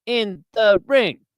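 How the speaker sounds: slow, high sportscaster cadence; Howard Cosell. Shown as a demonstration. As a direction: draw out IN THE RING with swooping pitch. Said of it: "all his inflections and cadence is kinda like William Shatner".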